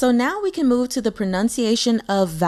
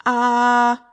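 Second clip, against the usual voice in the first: long "Ah" held level, louder; straight first tone; 2 semitones higher, 6 dB louder than usual. Shown as a demonstration. Teacher demonstrating Mandarin first tone, straight exaggerated "Ah". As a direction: sustain "Ah" on one even note, exaggerated. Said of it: "going to be a straight tone".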